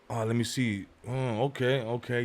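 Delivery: low, soft thinking aloud; absorbed muttering. Retold or imitated. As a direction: murmur while looking over papers. Defender looking over papers, retold.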